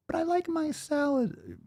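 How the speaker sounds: very high, soft and slow mocking whine; whiny viewer impression. Coach mocking a salad-loving viewer, high whiny voice. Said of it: "Have at it, Hoss".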